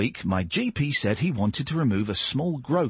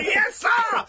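shouted yes sir. Acted. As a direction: bark it like a soldier. Mock salute after a joke.

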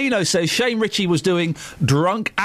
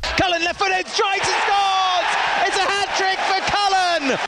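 goal call, pitched high and roaring; excited commentary; 10 semitones higher, slower than usual. Real, not acted. Football goal commentary clip.

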